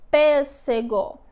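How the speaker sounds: "Pêssego" slowly, as pês-sé-gó; syllable by syllable. Shown as a demonstration. Teacher reading back the division, "Pêssego" slow and segmented. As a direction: give each syllable of "Pêssego" its full sound. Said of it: "fica assim na separação silábica".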